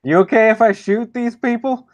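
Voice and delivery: high-pitched call back to a companion; shouting back. Pretend. Player shouts back to Silence for permission to shoot, calling out high. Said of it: "shout back in silence".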